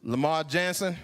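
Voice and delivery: usual speaking voice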